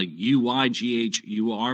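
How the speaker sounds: U Y G H U R spelled letter by letter, slowly; slow, careful spelling of U Y G H U R. Shown as a demonstration. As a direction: spell out U Y G H U R one letter at a time. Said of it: "It is spelled".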